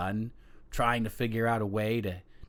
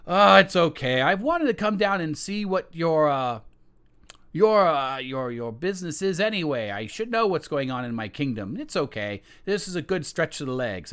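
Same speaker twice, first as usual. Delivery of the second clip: easygoing king; 5 semitones higher, 5 dB louder than usual. Acted. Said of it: "the king's like".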